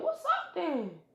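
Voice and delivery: lightly voiced girl squaring up; defiant challenge. Hinata accepts challenge.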